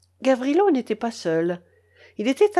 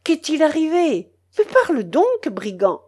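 urgent demand; 7 semitones higher, 5 dB louder than usual. Acted. Uncle demands an answer.

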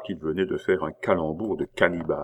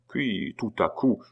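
plain reading voice

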